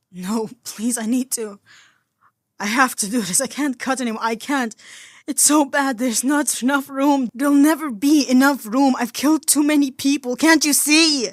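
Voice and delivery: from pleading to high, loud anguished sobbing; building, breaking tearful wail; hysterical crying. Acted. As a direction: spiral from begging into hysteria. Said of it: "Tony was crying hysterically now".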